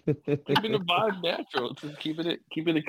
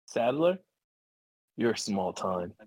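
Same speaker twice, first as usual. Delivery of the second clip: cool Leon line; 7 semitones lower, slower than usual. Acted. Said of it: "You actually, like, nailed it".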